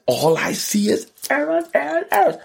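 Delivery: very high imitation of awed onlookers; high mock amazement. Imitating people studying his called shot, high mock amazement. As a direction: pitch it way up, imitating amazed commentators. Acts out future admirers' reaction.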